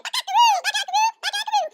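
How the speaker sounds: high squawked chicken call; playful high-pitched rooster crow. Acted. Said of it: "What does a chicken do?".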